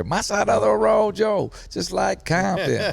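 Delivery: high, slow West Coast rap drawl. Retold or imitated. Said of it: "He did sound like [name]".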